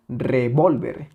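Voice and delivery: revólver slowly with a heavy second syllable; stress on VOL. Shown as a demonstration. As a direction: lean hard on VOL in revólver, then fall. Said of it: "Si le quitamos esa intensidad".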